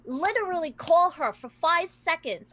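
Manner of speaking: high, loud exasperated rant; exasperated sister. Acted. Exasperated sister quarrels with brother.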